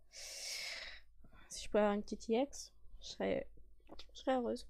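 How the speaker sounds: soft wishful whisper; hopeful whisper. Real, not acted. Wishing for a rare card.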